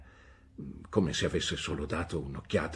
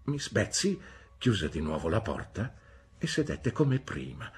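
ordinary delivery

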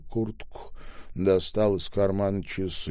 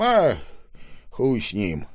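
ordinary delivery

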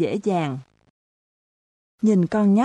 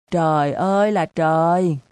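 despairing cry. A narrator's reading. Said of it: "kêu lên được có bốn tiếng".

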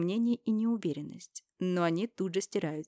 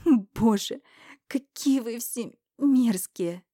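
much louder, slow, higher hysterical cry; hysterical outburst; 5 semitones higher, 7 dB louder, much slower than usual. Acted. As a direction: break into near-hysteria. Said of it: "истерический смех". (истеричный выкрик, медленно и громко)

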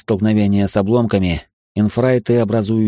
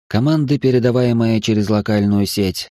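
ordinary delivery, nothing marked

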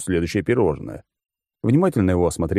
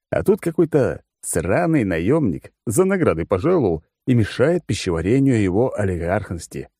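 plain reading voice

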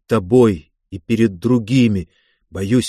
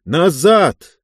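sharp loud shout, higher; 5 semitones higher, 4 dB louder, much slower than usual. Acted. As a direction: yell the warning. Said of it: "крича".

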